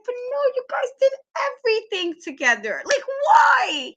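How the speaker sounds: high, wailing mock anguish; mock heartbroken protest. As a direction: plead in a high put-on whine. Acting out hurt fan's protest.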